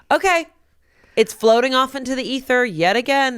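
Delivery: high, airy mock acceptance; breezy letting-go. Actor releasing an audition into the ether, mock breezy.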